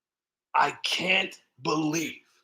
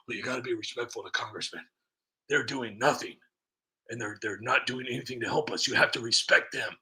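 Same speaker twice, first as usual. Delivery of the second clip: sneering parody of critics' lecturing; mock the critics who lecture about respect; mock scolding; 6 semitones lower, 7 dB quieter than usual. Vlogger mocking critics who demand respect for congressmen, sneering.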